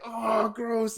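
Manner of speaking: high, drawn-out mock disgust; disgusted wife. Wife hears the teacher's crude definition, grossed out.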